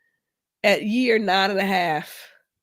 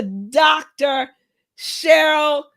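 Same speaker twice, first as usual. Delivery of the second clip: loud joyful cheer; excited shout; 4 semitones higher, 8 dB louder, slower than usual. Real, not acted. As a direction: cry out her name in celebration. Cheering a graduate by name.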